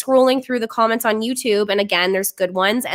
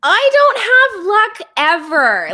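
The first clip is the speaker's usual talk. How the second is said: high, slow mock wail; 11 semitones higher, 5 dB louder, much slower than usual. Real, not acted. Laments her bad dating luck.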